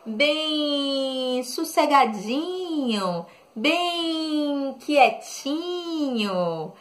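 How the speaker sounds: "sossegadinho" and "quietinho" very slow and soft; softly slowed rhymes, soothing like a lullaby; slow and calm. Shown as a demonstration. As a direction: drawl the rhyming words gently and slowly. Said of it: "bem calminho".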